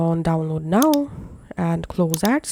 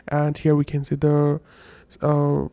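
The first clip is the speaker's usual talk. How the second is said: male pitch effect; 3 semitones lower than usual. Shown as a demonstration. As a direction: lower voice through a voice changer. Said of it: "you can choose male pitch".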